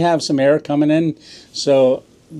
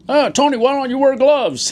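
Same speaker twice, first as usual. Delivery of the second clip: nagging voice; 11 semitones higher than usual. Retold or imitated. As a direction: mimic a fussing viewer. Mimics a nagging viewer's question.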